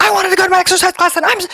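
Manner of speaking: put-on high, fast childish whine; a mom whining to herself in the car about missing her exercise class; mock whine. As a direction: sulk like a kid who lost a treat.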